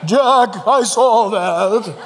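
hammed-up, drawn-out divine voice; mock voice of God. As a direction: boom it out like a comic God. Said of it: "He doesn't talk like that".

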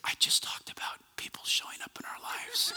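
hushed, awed stage whisper; whispered thought. Retold or imitated. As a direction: whisper a dawning realization to yourself. Acts out his awed private thought.

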